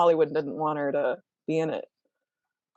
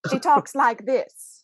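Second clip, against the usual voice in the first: slow, higher "talks" in a clipped mid-Atlantic lilt; Hepburn mid-Atlantic voice; 7 semitones higher, slower than usual. Shown as a demonstration. Host redoing the Hepburn impression on request, slow mid-Atlantic. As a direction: imitate Katharine Hepburn's mannered speech. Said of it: "Say that again".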